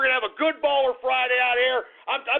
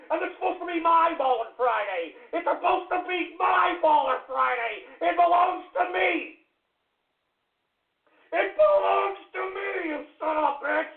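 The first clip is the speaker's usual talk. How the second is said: high, wailing protest; aggrieved yelling; 4 semitones higher than usual. Real, not acted. Host whose Baller Friday is being spoiled, aggrieved yelling. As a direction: insist on ownership in an outraged wail.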